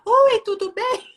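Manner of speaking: high-pitched, hammed-up character greeting; theatrical child reading. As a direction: imitate a boy acting out his book. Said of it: "fazendo altas encenações".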